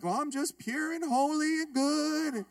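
wife's put-on calm, gently mocked; mock holy. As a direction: play it off as pure and serene. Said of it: "She played it off like".

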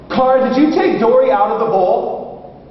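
put-on high, singled-out question to a child; coaxing father. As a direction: imitate a dad carefully investigating. Father questions young daughter.

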